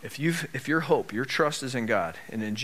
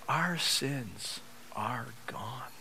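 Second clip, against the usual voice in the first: very slow, soft and weighty; hushed awe; 3 semitones lower, 12 dB quieter, much slower than usual. Real, not acted. Preacher telling church what comforts them, hushed awe. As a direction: let each word land quietly, far softer.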